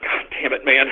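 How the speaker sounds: breathless under-the-breath curse; a whispered damn, almost no voice; muttered curse. Real, not acted. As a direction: mutter it to yourself, defeated. Muttering after outburst.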